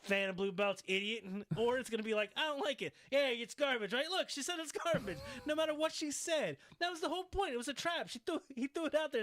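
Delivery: high-pitched, worked-up rant; mock badgering. Host acting out the trap set for Paige, badgering. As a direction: pounce on every possible answer.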